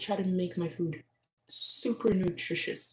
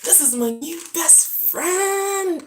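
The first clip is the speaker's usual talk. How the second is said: high, slow gushing; high slow delight; 10 semitones higher, 3 dB louder, slower than usual. Real, not acted. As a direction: gush over your find. Shows the bottle, delighted.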